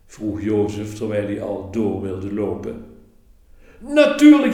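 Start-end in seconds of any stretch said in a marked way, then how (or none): 3.8-4.5 much higher and louder, a happy burst; joyful cry. A narrator's reading. Innkeeper boy welcomes Mary and Joseph, overjoyed and loud. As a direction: throw the door open with delight. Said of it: "riep Ron blij".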